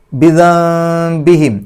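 very slow, high, loud ബിദംബിഹിം with a long m hum; n turned to m. Shown as a demonstration. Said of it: "നൂനിൻ്റെ ശബ്ദം മീമിൻ്റെ ശബ്ദമാക്കി". (ബിദംബിഹിം: നൂൻ മീമാക്കി മണിക്കുക)

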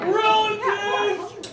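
high, slow, drawn-out cry of indignation; exaggerated squeal of complaint; outraged exclamation. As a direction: stretch it out in mock disbelief.

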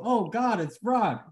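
high-pitched acted cry at no extra volume; half-voiced yell of recognition; mock yell. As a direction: pretend to yell, pitch jumping up. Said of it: "I yell, I go".

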